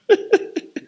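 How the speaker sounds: a quick high burst of laughter; short high laugh. Real, not acted. Preacher laughing at his own failure before the church, high burst. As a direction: laugh at himself.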